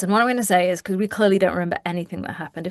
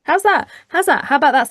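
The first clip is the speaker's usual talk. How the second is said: excited; 7 semitones higher, 5 dB louder than usual. Real, not acted. Excited pitch to the audience.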